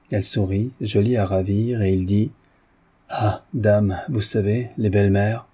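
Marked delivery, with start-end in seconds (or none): none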